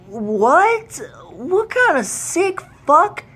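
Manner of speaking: high outraged cry of disgust; outraged disbelief. Real, not acted. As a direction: recoil in horror at what you read. Outraged at the prison line.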